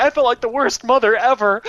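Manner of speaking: imitating mom bewailing her failure; guilty mother impression. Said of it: "my mother likes to tell this story".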